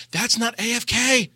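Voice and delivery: high-pitched fussy protest; exasperated diva whine; overdramatic drama queen. Retold or imitated. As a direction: object like a high-strung, effeminate raid leader. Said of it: "it was overdramatic like this".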